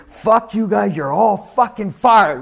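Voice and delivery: high-pitched furious boss, acted out; retold red-faced rage. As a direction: imitate the boss blowing up and firing everyone. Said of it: "Verne fucking just turns fucking red".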